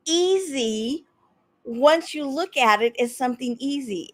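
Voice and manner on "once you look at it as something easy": ordinary talk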